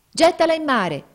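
high, loud shouted order; grido dei marinai. A narrator's reading. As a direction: shout the order across the water. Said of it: "gridarono i marinai". (ordine gridato, acuto e forte)

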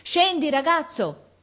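high, loud, drawn-out command; shouted order. A narrator's reading. Officer orders boy down, shouting. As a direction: shout it urgently up the tree. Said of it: "gridò l'ufficiale".

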